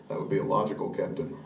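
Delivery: Spock impression. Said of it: "like Mister Spock".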